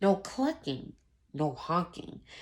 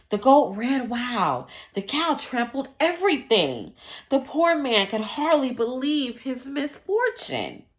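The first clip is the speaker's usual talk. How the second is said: building excitement; 3 semitones higher, 9 dB louder than usual. A narrator's reading. Narrator builds up the chaos.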